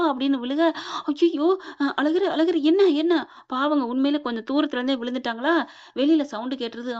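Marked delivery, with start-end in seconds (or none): 1.0-3.1 higher, louder flustered questioning; flustered, worried outcry; acted alarm. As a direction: stammer in mild panic over someone fallen. She panics after dropping him.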